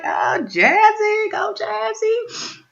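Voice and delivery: slow, high teasing cheer; mock cheering. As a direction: egg him on, high and drawn out. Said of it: "I'm like".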